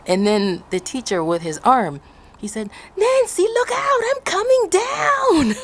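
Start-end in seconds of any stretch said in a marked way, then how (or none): 3.0-5.5 high, slow, loud called warning through laughter; laughing mock shout. Teacher on the hilltop, hammed-up shout with a laugh. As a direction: call out slowly, cracking up.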